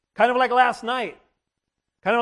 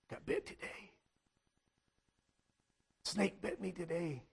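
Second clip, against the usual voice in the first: barely voiced whisper, much softer; whispered confession; 4 semitones lower, 18 dB quieter than usual. Dad coming home telling his family, whispered. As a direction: breathe it out like a doomed man.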